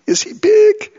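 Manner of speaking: very high, loud squeal on "big"; awed exclamation. Real, not acted. Preacher marvelling at God's greatness, high loud exclamation. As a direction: shoot way up in pitch with amazement.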